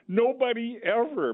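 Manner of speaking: deadpan punchline with a high sarcastic lift. Real, not acted. Repeats the quip for effect.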